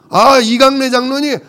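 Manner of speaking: high-pitched acted recognition of a name; delighted recognition. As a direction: pipe up as if you know them well. Acts out recognising an elder's name.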